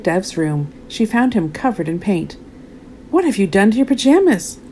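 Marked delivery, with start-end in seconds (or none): 3.1-4.6 high, shocked cry; cried out. A narrator's reading. Mum finding paint on pajamas, crying out. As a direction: exclaim in dismay. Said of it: "cried Mum".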